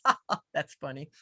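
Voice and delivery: quiet amused chuckle through the words; laughing. Retold or imitated. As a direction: laugh it off softly to yourself. Retelling own amused thought.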